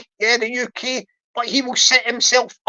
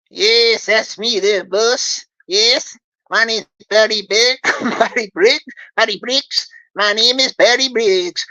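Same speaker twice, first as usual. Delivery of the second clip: put-on American accent; 2 semitones lower than usual. Scammer Barry answering the host in a fake American accent. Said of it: "he'll be talking like this".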